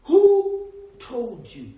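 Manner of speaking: loud, slow, drawn-out question as God; God demanding. Acted. God confronts Adam over being naked, loud slow demand. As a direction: press the question hard, stretching the words. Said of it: "God said".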